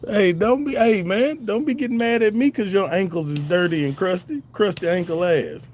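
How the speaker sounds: high-pitched teasing comeback; mock indignant. Real, not acted. Streamer roasting a viewer back over ankles, mock indignant. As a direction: pitch it up in playful protest.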